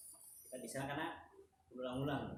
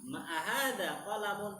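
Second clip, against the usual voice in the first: "Ma ahada qalamun" higher, louder and slower; question intonation; 5 semitones higher, 5 dB louder, slower than usual. Shown as a demonstration. Teacher posing an example question, "Ma ahada qalamun" raised and drawn out. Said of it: "dalam berintonasi ketika ngobrol".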